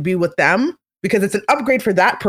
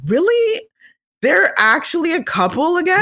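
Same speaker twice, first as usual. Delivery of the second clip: mock disbelief; 9 semitones higher than usual. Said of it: "So I'm like".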